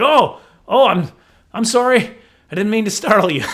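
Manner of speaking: loud, high, flustered retelling; startled apology. Retold or imitated. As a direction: blurt an apology after a fright.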